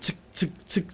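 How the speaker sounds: whispered rhythmic chk-chk; soft unvoiced fan noise; imitated fan whirr. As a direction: breathe out the fan's steady chopping sound. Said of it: "fan लगाया आपने".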